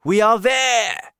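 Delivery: high, loud, drawn-out cry; excited announcement. A narrator's reading. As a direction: proclaim the arrival loudly. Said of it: "cried the old green grasshopper".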